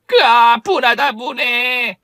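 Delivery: high, loud seething cry; bitter fury. Acted. Arrested gambler rages at her bad luck, high bitter cry. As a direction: spit out the humiliation.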